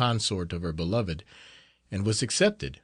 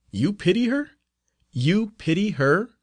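disbelieving; 9 semitones higher than usual. Acted. Novice repeats in disbelief.

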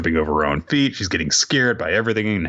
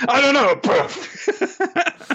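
laughing; much slower than usual. Retold or imitated. Host retelling the sphinx joke, laughing hard. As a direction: crack up while quoting.

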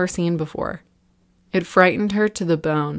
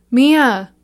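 high, loud desperate yell; 6 semitones higher, 7 dB louder than usual. A narrator's reading. Liam yelling for Mia as the door slams, loud. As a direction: yell her name in panic. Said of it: "Liam yelled".

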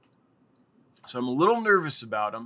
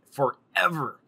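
usual speaking voice

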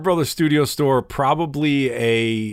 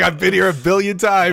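high, loud, faked wonder; mock amazement; 7 semitones higher, 4 dB louder than usual. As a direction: act like it's brand new. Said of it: "It's like".

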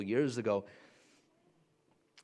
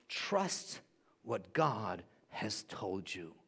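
slow, much softer, lower and calm; slow soft assurance; 4 semitones lower, 6 dB quieter, much slower than usual. Shown as a demonstration. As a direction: let it sink in gently, slowly. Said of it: "repeated for emphasis".

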